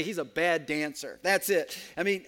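ordinary delivery

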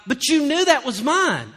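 loud, high accusing retort; acted-out quarrel complaint; mock accusation.

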